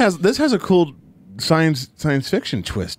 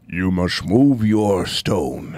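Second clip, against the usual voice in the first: slow, low booming owl; a deep, slow ominous owl; Great Owl voice; 9 semitones lower, much slower than usual. Retold or imitated.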